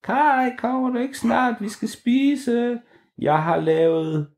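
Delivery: high, slow, drawn-out call from afar; mother calling. A narrator's reading. Mum calls Kaj home for dinner, high and drawn out. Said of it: "morens stemme igen, som sagde".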